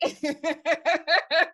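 a bright high burst of laughter. Real, not acted. Laughing at the guests' words. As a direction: laugh at the surprise of it.

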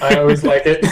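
bursts into high laughter; laughing. Real, not acted. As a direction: laugh along delightedly. Delighted by the lizard voice.

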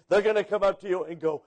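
ordinary talk

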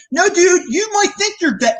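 mock warning. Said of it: "I'm gonna say".

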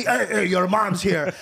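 slow, mock-angry dad scolding; imitating mad dad. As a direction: draw out the heys as a cross dad. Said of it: "then he gets mad at me".

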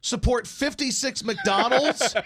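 high, slow, loud indignation; mock outrage. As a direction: read it like an outraged dad. Reading dad's post, mock outrage.

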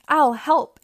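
high, loud eager squeal; excited squeal. A narrator's reading. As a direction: squeal it, keen to pitch in. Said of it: "everyone squealed at once".

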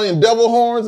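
ordinary delivery

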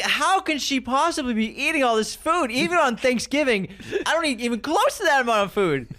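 high, incredulous mock disbelief; voicing my own disbelief watching her eat; incredulous inner voice. Host voicing his disbelief at her eating, high and incredulous. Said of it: "I'm like".

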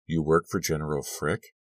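usual speaking voice

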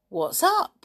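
high, loud call of alarm; startled question. A narrator's reading. Nobby finds the camp in uproar, startled.

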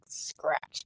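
soft mock scratch; whispered scratch. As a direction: hiss it playfully. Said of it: "putting my hand up and being like".

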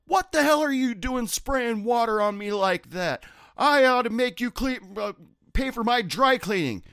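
high, loud furious complaint; mock the angry customer. Wet customer yelling at the bartender, high and loud. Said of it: "spitting fire, pissed off".

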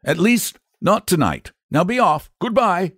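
mild grown-up firmness; kindly but firm. A narrator's reading. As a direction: send a child off kindly and firmly. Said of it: "said the man kindly but firmly".

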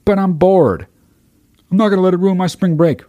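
high, fast, bratty mock of a bored partier; mocking spring breaker. Mocking spring breakers who say they're bored, in a high bratty voice. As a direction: sneer it like a careless spring breaker. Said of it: "mocking spring breakers' excuse".

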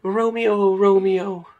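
high theatrical Juliet. Acted. Young actress rehearsing Romeo and Juliet, high theatrical declaiming. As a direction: spout it high like a stage heroine. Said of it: "she is just spouting off".

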